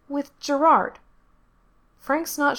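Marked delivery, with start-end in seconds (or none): 0.1-1.0 put-on much higher child voice; little girl voice. A narrator's reading. Little girl waking Frank at night, high child voice. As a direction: voice the small girl, high and bright.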